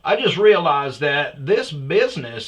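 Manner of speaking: loud, high mock boss voicemail; voicemail rant. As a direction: blast it like a pumped-up host. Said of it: "my voicemail goes like this".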